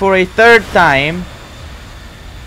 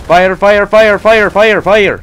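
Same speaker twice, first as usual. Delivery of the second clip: loud, rapid-fire repeated shouts; frantic firing command; 3 semitones higher, 5 dB louder, slower than usual. Pretend. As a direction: bark the order over and over. Gamer frantically ordering fire in battle.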